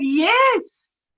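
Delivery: normal talking voice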